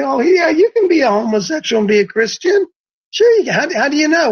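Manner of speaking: high, sing-song mock of a permissive believer. Preacher mocking churchgoers who accept it, high sing-song voice.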